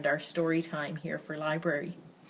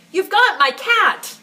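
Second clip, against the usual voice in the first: shouted high and loud, slowly; loud angry call; 11 semitones higher, 11 dB louder, slower than usual. A narrator's reading. Angry girl shouting at the neighbour boy, loud. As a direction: yell it out in a temper. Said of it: "calling out in a loud voice".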